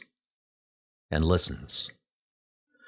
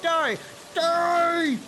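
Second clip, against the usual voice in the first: savage yell, rising on each die; wild angry shouting; furious battle cry. Acted. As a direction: strike at the beast with all your rage. Man attacking the horse.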